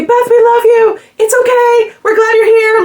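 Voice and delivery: high, loud mock crowd yell; crowd yelling reassurance. Fans yelling reassurance at the shy singer, high loud call. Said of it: "people would say".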